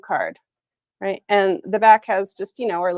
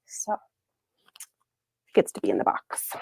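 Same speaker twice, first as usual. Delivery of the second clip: slow, low conspiratorial whisper; 13 semitones lower, 6 dB quieter, much slower than usual. Real, not acted. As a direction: whisper it like a secret, slowly. Playful aside about the quote.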